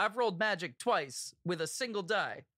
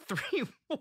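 laughing; 4 semitones higher than usual. Real, not acted. Laughing at a disastrous dice roll.

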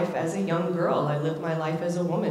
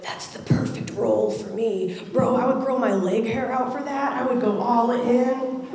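excited fantasizing; 5 semitones higher than usual. Retold or imitated. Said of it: "I was like".

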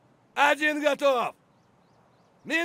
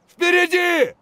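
shouted callout; 5 semitones higher, 6 dB louder than usual. Acted. Soldier spotting enemy ahead, shout. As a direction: yell it sharply.

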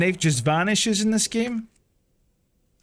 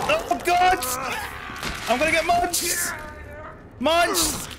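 high panicked cries; frantic, high-pitched terror; panicking gamer; 9 semitones higher, slower than usual. Real, not acted. Zombies about to bite.

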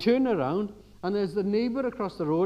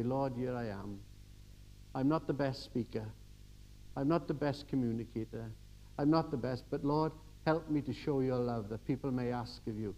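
soft, low murmured prayer; humble prayer; 5 semitones lower, 7 dB quieter than usual. Pretend. Praying softly to the Lord, humble and quiet.

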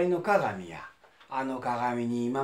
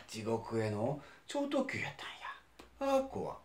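whispering; 4 semitones lower, 7 dB quieter than usual. Acted. Frightened aside.

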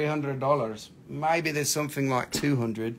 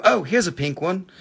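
ordinary delivery

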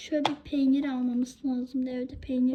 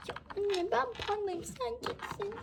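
put-on child voice; 6 semitones higher, 8 dB quieter than usual. Child toy voice talking to mother.

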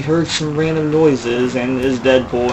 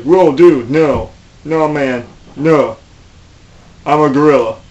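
loud dude voice; 2 semitones higher, 6 dB louder than usual. Pretend. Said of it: "Donkey Kong was all like".

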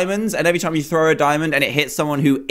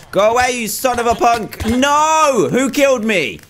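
frustrated yelling; 8 semitones higher than usual. Real, not acted. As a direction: yell it out as you lose. Getting killed in the game.

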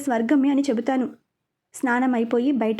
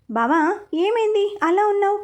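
higher, sharp call across the room; a mild mock shout to snap him out of it; calling out loudly; 7 semitones higher than usual. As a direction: call out, pitched well up. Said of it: "గట్టిగా అరుస్తూ పిలిచాను".

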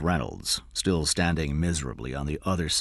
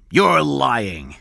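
loud, raised accusing voice; angry accusation; 8 semitones higher, 8 dB louder than usual. A narrator's reading. Cy grabs the boy's arm and accuses him, angrily. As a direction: accuse forcefully, pitch up. Kidnapper roughly accuses boy.

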